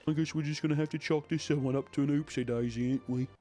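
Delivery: a light put-on English accent; mock British accent. Mocking how British officials sound, light English accent. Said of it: "That's what British people sound like".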